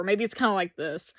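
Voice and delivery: usual speaking voice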